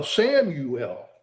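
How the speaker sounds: ordinary talk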